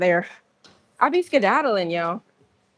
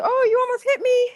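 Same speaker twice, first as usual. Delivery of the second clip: much higher, cutesy imitation of a guy flirting; cute mock man. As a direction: mimic him acting cute and coy. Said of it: "But he said it kinda cute".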